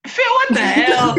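shrieking with laughter, high; laughing shriek. Real, not acted. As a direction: squeal it out laughing. Laughing shock at friend's drink.

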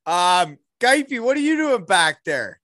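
calling out. Real, not acted. Friend hiding in background called out loudly. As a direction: call across the room to get his attention.

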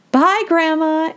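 put-on high singsong little-girl farewell, drawn out; playful, laughing tease. A narrator's reading. Annie jokingly calls Sylvia her grandmother, playful. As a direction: tease slowly in a high, amused voice. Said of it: "Annie said with a laugh".

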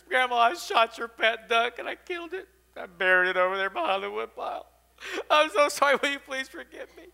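high, loud tearful confession, acted; weeping. A narrator's reading. Boy begs grandmother to forgive him, mock weeping. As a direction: plead like a wailing little boy. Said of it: "He just starts weeping".

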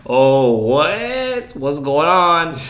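high-pitched, drawn-out confusion; exaggerated wondering, stretched at the end; acted bewilderment. Food runner seeing table laugh at them, bewildered.

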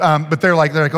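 usual speaking voice

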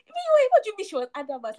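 high, rapid-fire challenge; acted incredulity. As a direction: cut in with mock disbelief.

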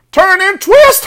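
very high, loud goose squawk; shrieked goose order; honking goose. A narrator's reading. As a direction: honk the command urgently. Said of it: "hawked the goose".

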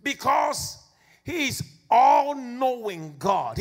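building, emphatic sermon declaration; full-force preaching. Acted. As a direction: proclaim it with full conviction.

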